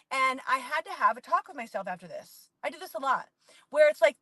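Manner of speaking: ordinary talk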